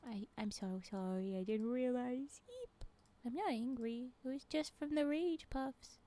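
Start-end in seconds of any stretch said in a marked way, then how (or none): 2.5-2.8 very high startled eep; tiny squeak. Real, not acted. Streamer yelping at a near miss, high squeak.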